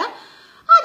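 very high loud shocked "ah"; shocked shriek. Retold or imitated. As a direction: shriek in disbelief. Exclaims in shock.

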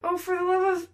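exasperated. Acted. Mary fed up with Dusty.

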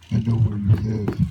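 low menacing growl; creepy monster voice. Pretend. Spooky threat voice to Jason.

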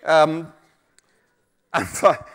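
ordinary talk

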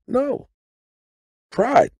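ordinary delivery

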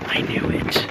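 under-the-breath told-you-so; whispered. Real, not acted. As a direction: whisper it to yourself.

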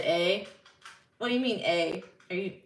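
ordinary delivery

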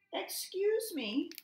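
high, slow little bird voice; small polite voice calling out. A narrator's reading. Bluebird calls out to Duck and Goose, small high voice. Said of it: "a little voice called out".

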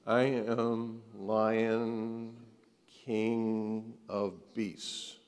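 slow, unsure reading; meek reading. Acted. Lion reading his list slowly, without conviction. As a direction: read it slowly, doubting it. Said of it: "Kashi reads".

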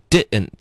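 "didn't" as di'-nt, second d dropped; glottal stop. Shown as a demonstration. Teacher showing the reduction, "didn't" with glottal stop. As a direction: slowly catch "didn't" in the throat. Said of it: "这是一个喉音停顿".

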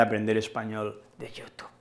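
softly whispered brag; whispered aside. Acted. Teacher whispering a brag about his YouTube channel. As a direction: whisper it like a secret.

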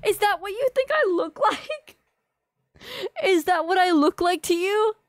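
mock offended.

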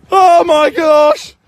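slow, loud, drawn-out exclamation; excited shout. Real, not acted. Commentator on the chaos of the catch, excited shouting. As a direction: bellow it, stretching the words.

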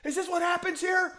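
high, stunned, slowing on the second question; disbelieving, high-pitched; shocked disbelief. Retold or imitated. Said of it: "I was like".

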